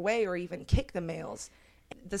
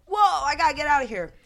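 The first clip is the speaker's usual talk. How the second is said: startled panic; 8 semitones higher, 8 dB louder, much faster than usual. Retold or imitated. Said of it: "was like".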